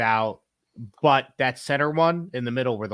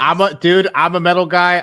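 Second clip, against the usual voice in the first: loud proud declaration; 5 semitones higher, 9 dB louder than usual. Real, not acted. Proudly declaring metal loyalty.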